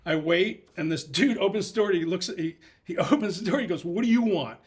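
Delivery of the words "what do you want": louder, higher curt question; gruff demand. Retold or imitated. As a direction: snap it at the door. Gruff guy opens door.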